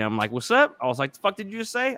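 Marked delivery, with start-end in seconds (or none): 0.3-0.8 mock challenge. Retold challenge to lippy people. 1.1-1.9 mock challenge. Retold challenge to lippy people.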